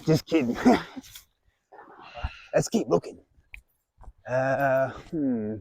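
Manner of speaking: broad put-on Australian accent, hearty host; jolly Australian reptile presenter; Australian wildlife host. Plays a crocodile-hunter style host.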